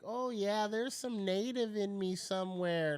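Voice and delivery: lightly high, mocking a wishful claimer; a hint of a dreamy wannabe voice. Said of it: "kinda like".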